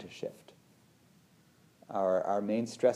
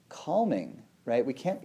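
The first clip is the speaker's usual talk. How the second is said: ordinary talk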